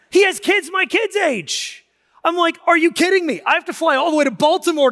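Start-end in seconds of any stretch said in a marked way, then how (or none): 2.7-4.9 higher, louder incredulous exclaim; like you can't believe the coincidence; amazed disbelief. Retold or imitated. Retold amazed meeting.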